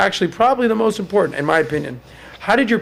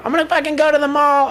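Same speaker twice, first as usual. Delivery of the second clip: much higher ditzy voice; mock airhead girl; 11 semitones higher than usual. Host mocking a woman's answer about spending, high ditzy voice. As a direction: play a shallow spender. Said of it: "you know".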